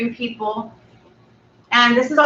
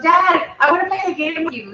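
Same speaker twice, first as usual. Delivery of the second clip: put-on higher, fast, eager kid voice; eager little girl; 7 semitones higher, faster than usual. Little girl asking her dad to play, eager. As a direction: bounce it like an excited child.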